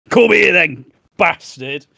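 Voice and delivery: high, loud play-fight challenge; hammed-up threatening shout; mock aggression. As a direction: call him out in pretend fury. Acting out jokey revenge on mate.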